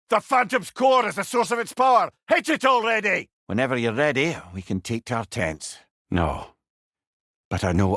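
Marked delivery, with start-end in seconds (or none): 0.1-3.3 loud, high battle instruction; urgent shout. Acted. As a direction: bark the order across the battlefield. Urging attack in combat.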